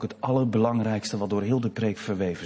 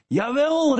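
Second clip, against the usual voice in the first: high, louder call of reassurance; sister calling up; 6 dB louder than usual. Retold or imitated. Sister calling up to her brother, high reassuring call. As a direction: call up brightly to him. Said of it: "riep zijn zus".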